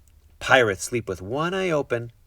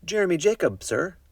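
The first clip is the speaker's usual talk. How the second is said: put-on polite boy voice; 9 semitones higher than usual. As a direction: answer politely like a small boy. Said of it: "I told him".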